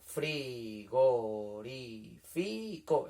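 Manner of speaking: frigorífico stretched into five separate beats, fri-go-rí-fi-co; counting syllables. Shown as a demonstration. Teacher counting voice beats with pupils, frigorífico slowly syllable by syllable. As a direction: chant frigorífico slowly, one beat per syllable. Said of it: "y vamos contando".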